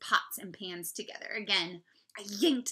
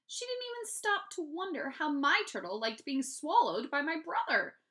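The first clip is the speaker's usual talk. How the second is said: indignant; 9 semitones higher, 6 dB louder than usual. A narrator's reading. Narrating brother is indignant.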